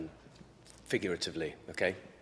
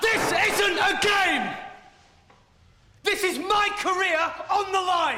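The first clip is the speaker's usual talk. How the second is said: angry shout; 7 semitones higher, 5 dB louder than usual. Acted. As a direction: bellow it, losing control. Doctor yells back in quarrel.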